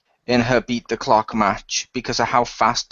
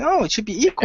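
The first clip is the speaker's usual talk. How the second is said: high-pitched mock plea; put-on high protest; mocking fan imitation; 10 semitones higher, faster than usual. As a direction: mimic a naive complainer. Mocking fans' inconsistent demand.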